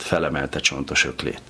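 usual speaking voice

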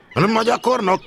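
high, loud summons; shout. A narrator's reading. Stationmaster calling the trainee into his office, shout. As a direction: bellow it out like a boss. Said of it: "kiáltotta a főnök úr".

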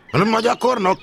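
loud summoning shout; shouted call. A narrator's reading. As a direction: call the trainee sharply across the room. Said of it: "kiáltotta a főnök úr". (hangos kiáltás)

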